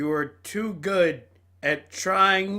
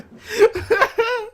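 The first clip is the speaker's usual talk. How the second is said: high laugh; 5 dB louder than usual. Real, not acted. Laughing at scene transition.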